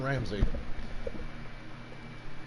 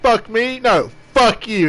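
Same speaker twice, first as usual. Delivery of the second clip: higher, louder mock outrage, the second part slow; Gordon Ramsay comeback; 7 semitones higher, 4 dB louder, slower than usual. Streamer replaying the chef's retort to the cook, mock indignant. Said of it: "That really made me laugh".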